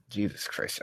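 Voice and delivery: usual speaking voice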